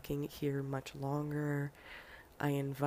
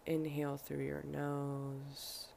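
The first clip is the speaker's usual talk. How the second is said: guided breathing meditation; 6 dB quieter than usual. Acted. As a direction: lead each breath softly, leaving room to breathe.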